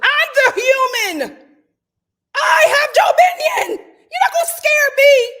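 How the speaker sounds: loud, high battle cry; hammed-up declaration of dominance; defiant shout. Real, not acted. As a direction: yell it out to scare the creatures. Yelling at animals outside.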